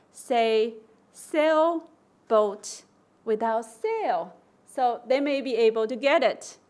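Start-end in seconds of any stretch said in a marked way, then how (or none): 0.1-4.3 sailboat drawn out very slowly; slow deliberate prompt for a child; slow teacher prompt. Shown as a demonstration. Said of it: "you stress the two syllables".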